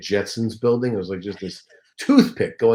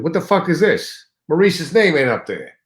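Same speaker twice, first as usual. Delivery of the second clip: loud, high indignant outburst, faster at the end; outraged yell; 8 semitones higher, 8 dB louder than usual. Retold or imitated. Star yelling at staff over billing, loud outraged. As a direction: blow up about the missing name on the marquee. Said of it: "He said".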